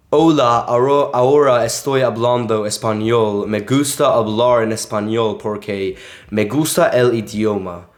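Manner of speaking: flat American gringo Spanish; Spanish with heavy American vowels; Spanish spoken like an English speaker; American accent. Shown as a demonstration. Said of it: "if you said it like that".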